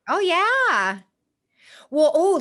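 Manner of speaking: light up at what you are being shown; real delight. Real, not acted. Host reacting to a guest's logo reveal, delighted.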